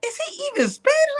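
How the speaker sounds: high squeaky mock disbelief; baffled supervisor.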